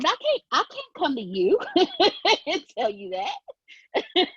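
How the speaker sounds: laughing while speaking; giggling through the words. Real, not acted. Laughing at her own voice.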